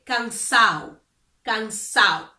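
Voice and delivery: cansado said as "cansao", no D; dropped D. Shown as a demonstration. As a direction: slide through cansao. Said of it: "without pronouncing the D".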